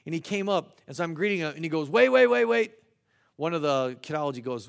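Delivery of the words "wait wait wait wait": loud, raised-pitch rapid repeats; urgent interruption. Retold or imitated. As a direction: cut in loudly to stop everyone. Acted interruption of a greeting.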